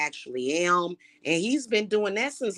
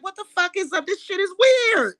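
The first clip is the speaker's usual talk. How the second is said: high, baffled mock outrage; incredulous onlookers; 13 semitones higher, 5 dB louder than usual. People watching the hater, mocked in high incredulous outrage. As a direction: voice other people's shocked disbelief, pitched way up. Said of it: "people that are like".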